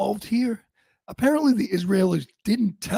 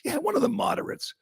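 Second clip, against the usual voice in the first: sarcastic whisper; 8 semitones higher, 5 dB quieter, faster than usual. Real, not acted. Mocking the media calling him a moderate, sarcastic whisper. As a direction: hush it, dripping with sarcasm.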